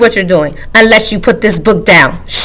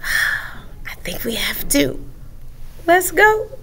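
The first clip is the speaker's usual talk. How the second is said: sighing whisper; hushed conspiratorial whisper; 6 semitones higher, 14 dB quieter, slower than usual. Acted. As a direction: breathe it out after a sigh. Whispering to the children.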